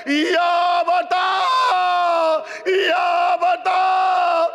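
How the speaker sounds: keen the tragedy so the hall weeps; full-force lament preaching. Acted. Majlis lament, voices Sakina and others.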